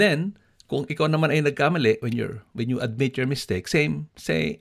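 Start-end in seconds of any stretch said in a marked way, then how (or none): none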